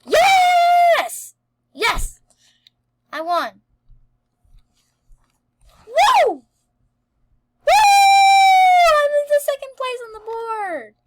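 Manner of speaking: very high, loud shriek; victory shout. Real, not acted. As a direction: celebrate the win. Just won the minigame.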